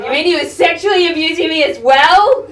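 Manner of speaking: loud, rising disbelieving question; hammed-up outraged disbelief; mock incredulous outburst. Acted reaction to hotline's news.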